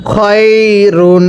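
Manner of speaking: খয়রুন stretched very slowly; slow model reading of খয়রুন; slow drawn-out reading. Shown as a demonstration. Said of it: "কিভাবে পড়তে হবে".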